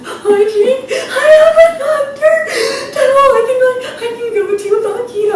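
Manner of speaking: high, loud tearful wail; hammed-up sobbing through the words; mock bawling. Patient retells her breakdown to Bill, mock bawling. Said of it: "bawling, sobbing".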